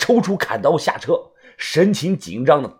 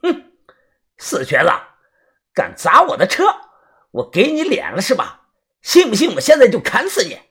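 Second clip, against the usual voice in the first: sneering threat; 7 semitones higher than usual. A narrator's reading. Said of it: "李康阳冷笑着".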